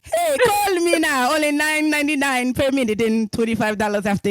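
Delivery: Jamaican psychic ad voice; Jamaican accent. Retold or imitated. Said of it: "a Jamaican accent to talk like Cleo".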